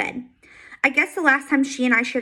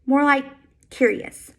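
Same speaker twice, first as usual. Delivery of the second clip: ordinary delivery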